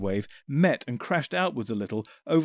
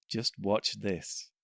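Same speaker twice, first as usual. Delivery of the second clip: soft, slow murmur; low aside to the Time Lords; 3 semitones lower, 6 dB quieter, much slower than usual. Acted. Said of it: "he murmured to the two Time Lords".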